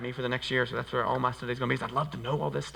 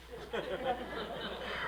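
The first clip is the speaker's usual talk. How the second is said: laugh; 9 semitones higher, 10 dB quieter than usual. Real, not acted. Laughs after the line.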